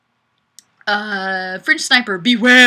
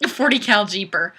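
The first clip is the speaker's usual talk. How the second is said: normal talking voice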